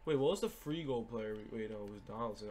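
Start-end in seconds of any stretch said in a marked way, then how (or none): none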